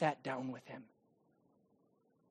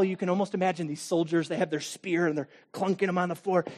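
ordinary talk, nothing marked

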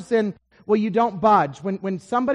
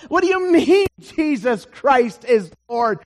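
loud, higher-pitched disbelief; incredulous challenge; 4 semitones higher, 6 dB louder than usual. Acted. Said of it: "to the Greek and to the Roman, it, it's, it's like".

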